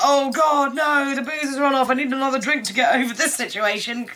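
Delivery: mock panic over no booze; mock distress. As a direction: whine about the booze running out. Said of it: "she's a bit like".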